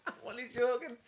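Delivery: high, giggly backtrack; laughing reassurance. Real, not acted. Teaser excuses a joke to the co-host, laughing. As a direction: take back the tease through laughter.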